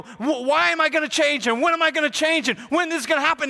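high, rushed questions; impatient pleading. Acted. Acts out impatient people asking God.

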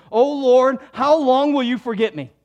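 voiced psalmist crying out; anguished lament. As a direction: plead loudly in despair. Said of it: "David is saying".

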